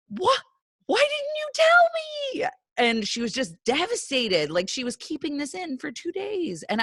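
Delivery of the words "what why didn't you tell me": high, drawn-out mock wail of dismay; horrified outcry. Stepmom to crying stepdaughter, acted horrified wail. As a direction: act out shocked distress, pitched way up. Acting own horrified reply to kid.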